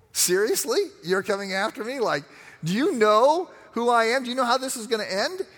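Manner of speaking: high, amused disbelief at a tiny challenger; incredulous scoff. Retold or imitated. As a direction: scoff down at the little cat, amazed it dares. Eagle voiced scoffing at cat.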